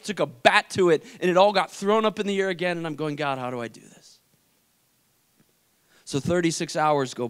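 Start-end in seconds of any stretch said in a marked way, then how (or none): 3.1-4.2 low, soft, defeated question; weary prayer. Retold or imitated. Brother asking God after news of nephew, weary. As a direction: let it sink down quietly.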